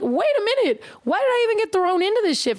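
high, rushed, acted protest; mock indignant. Retelling being tagged in a post, mock indignant.

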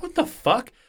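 high shocked what the fuck; disbelief. Retold or imitated. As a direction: jump way up in outrage. Listener's outraged disbelief.

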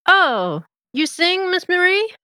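thrilled to learn she sings; excited Mater. Acted. Mater delighted by singing.

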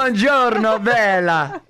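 high, hammed-up Italian charmer; exaggerated Italian greeting; Italian accent. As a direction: flirt in a mock-Italian accent.

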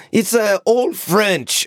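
high, slow mocking quote; lightly voiced French friends; imitating French friends. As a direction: mimic them answering vaguely. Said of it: "They're like".

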